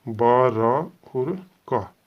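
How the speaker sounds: very slow, each part held apart; syllable by syllable. Shown as a demonstration. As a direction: read पा, रा, सा out like a teacher. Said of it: "तो ये हमारा आएगा". (धीरे-धीरे पढ़ना)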